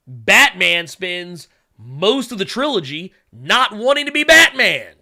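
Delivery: exasperated fan making his point; building rant. Real, not acted. Rising rant about trilogy.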